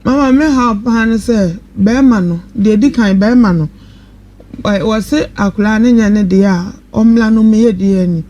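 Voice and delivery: ordinary talk, nothing marked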